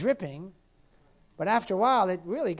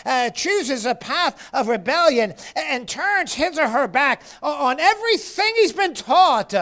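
raised, impassioned sermon delivery; fervent preaching; 8 semitones higher, 8 dB louder than usual. Real, not acted. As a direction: preach it hard, punching the key words. Preaches at full force.

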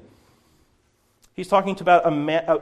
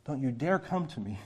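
hushed warning; 7 dB quieter than usual. Acted. God warning the people, hushed and stern. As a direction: warn them quietly but firmly. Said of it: "I said that very harshly".

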